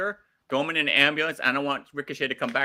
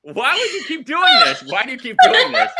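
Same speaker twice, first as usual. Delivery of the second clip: high, loud laughing exasperation; exasperated laughing; 9 dB louder than usual. Real, not acted. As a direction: cry out in laughing disbelief. Reacting to hated noise button.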